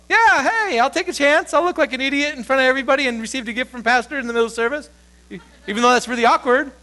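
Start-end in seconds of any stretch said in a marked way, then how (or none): 0.1-4.9 high, quick, eager voice, a little sheepish; bold volunteer. Retold or imitated. A bold churchgoer volunteers for the pastor's gift, high and quick. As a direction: pipe up high and fast, taking a chance. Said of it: "somebody would be bold enough to go".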